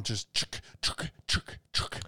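clipped chuk nail gun sounds; nail gun noise. Retold or imitated. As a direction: mimic a nail gun firing.

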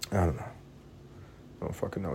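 whispered, slow and drained; exhausted whispered shrug; weary whisper. Real, not acted. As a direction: give up under your breath. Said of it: "let me calm myself down".

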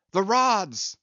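high, loud urgent shout; urgent cry. A narrator's reading. Starbuck shouting to the crew, loud alarm. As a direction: cry out the alarm over the storm. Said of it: "cried Starbuck to the crew".

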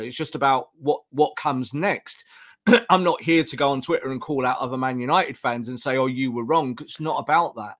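usual speaking voice

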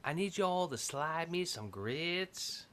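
put-on slow, soft Southern accent with a questioning lilt. Put on the spot by friends, slow lilting Southern accent. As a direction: attempt a Southern accent, as if asking. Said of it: "you sound like you're asking".

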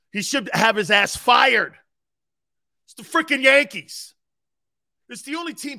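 loud, demanding New York fan rant; ranting Yankee fan. Acted. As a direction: rant like an impatient fan the day after a title. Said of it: "here's Yankee fan".

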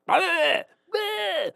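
high, loud mocking toddler bleh; toddler tantrum noise. Dad mimics his toddler's high bleh. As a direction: imitate a toddler losing it. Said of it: "and he's just like".

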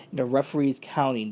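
normal talking voice

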